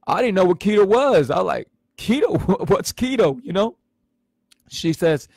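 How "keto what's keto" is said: puzzled laughing question. Retold or imitated. Confused about unknown diet word.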